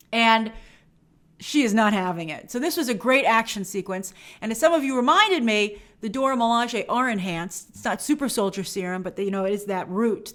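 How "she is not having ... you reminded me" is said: normal talking voice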